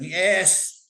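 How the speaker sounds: drawn-out rising "yes"; imitated little cheer; triumphant approval. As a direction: let the word swell in triumph. Voices own triumphant approval in story.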